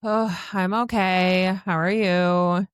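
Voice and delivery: very slow, unenthusiastic reply; honest, subdued okay. Acted. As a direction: drag it out without cheer. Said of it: "more honest".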